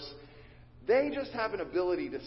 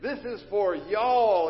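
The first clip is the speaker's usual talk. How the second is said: louder, slow, stressing "y'all"; emphatic y'all; 3 semitones higher, 6 dB louder, slower than usual. Shown as a demonstration. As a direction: land on "y'all". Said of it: "emphatic Southern phrase".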